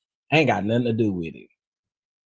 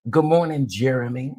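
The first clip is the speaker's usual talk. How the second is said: normal talking voice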